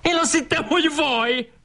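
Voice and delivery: very high schoolboy squealing, no added volume; hammed-up shrieky outburst; excited yelling. A narrator's reading. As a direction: cry out in a rush of high protest. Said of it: "kiabált Andrási".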